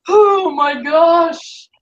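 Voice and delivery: long drawn-out shocked cry; startled exclamation. Real, not acted. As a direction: stretch it out in alarm. Cat jumps on her.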